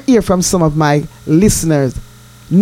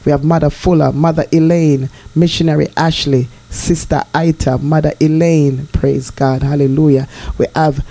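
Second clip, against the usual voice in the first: lower, solemn scripture reading; prayerful reading; 6 semitones lower than usual. Acted. To her listeners, blessing them in a solemn prayerful voice. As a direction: read the psalm and bless them reverently.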